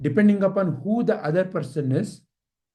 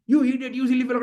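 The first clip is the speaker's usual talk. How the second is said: higher, gabbled mock rant; imitated shouting; 5 semitones higher, much faster than usual. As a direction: imitate an angry person shouting insults. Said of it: "you know how when people shout".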